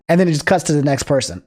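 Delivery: ordinary delivery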